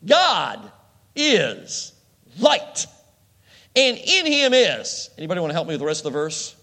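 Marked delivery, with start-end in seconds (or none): 0.1-5.1 each word of "God is light" spaced and loud, high; slow emphatic recitation. Acted. Preacher reciting verse to congregation, slow loud emphasis. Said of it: "First John one five".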